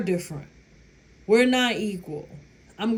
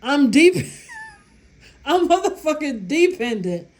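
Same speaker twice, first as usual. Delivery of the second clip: louder and higher, laughing on the words; 6 semitones higher, 6 dB louder than usual. Real, not acted. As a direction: break into laughs mid-claim. Cracking up at her own joke.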